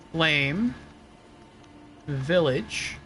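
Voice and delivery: ordinary talk, nothing marked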